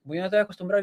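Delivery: ordinary talk, nothing marked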